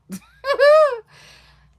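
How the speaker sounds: loud high burst of laughter; big high laugh. Real, not acted. Laughing at herself about losing everything, loud high laugh.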